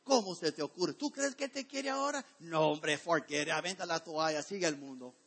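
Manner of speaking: a hint of the devil mocking a believer; the devil accusing. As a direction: taunt the sinner as the tempter turned accuser. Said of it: "el mismo ... ahora te acusa".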